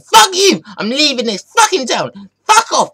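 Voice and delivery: loud, very high screeching fury; rage quitting. Acted. Player storming out on rival, high-pitched furious shouting. Rage quit after game cut.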